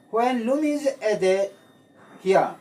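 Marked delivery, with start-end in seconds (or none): none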